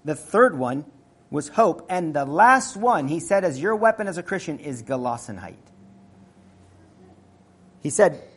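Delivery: ordinary talk, nothing marked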